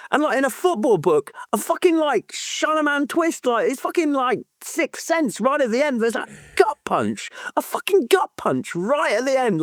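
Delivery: excited rave. Real, not acted. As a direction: pitch way up, gushing about the twist. Raving about the twist ending.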